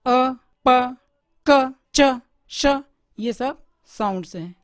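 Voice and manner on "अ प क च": isolated sounds said loud and crisp; sound demo. Shown as a demonstration. Teacher sounding out letters for the class. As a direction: voice each sound alone, clearly. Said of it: "जब हम अपने मुंह से बोलते हैं ना".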